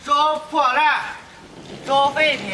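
high, drawn-out junk collector's cry; singsong scrap-buyer call, pitched high; street hawker's call. Acted. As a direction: call out to the whole lane for scrap.

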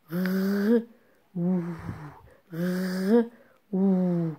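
R as a held sound, then a held ou; separate letter sounds. Shown as a demonstration. Teacher sounds out the syllable ro for pupils, sound by sound. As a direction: sound out R, then ou, each alone. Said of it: "je vois deux sons".

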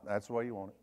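wife's retort. Retold or imitated. Wife answers her husband's complaint in a low, dry tone. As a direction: say it low and dry, case closed.

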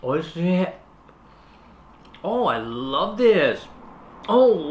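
talking between bites; chewing while talking. Real, not acted. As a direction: mouth partly full. Seen 0: Floral-shirt speaker bit the snack at -4, chewing with closed lips early on.

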